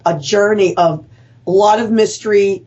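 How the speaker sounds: ordinary talk, nothing marked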